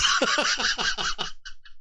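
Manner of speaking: evil chuckle, breathy and unvoiced; a gleeful evil snicker; villain laugh. Real, not acted. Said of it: "that's a villain laugh".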